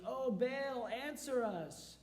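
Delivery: high, slow, pleading call; high drawn-out plea; calling out to Baal. Acted. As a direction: cry out to a silent god, stretching it.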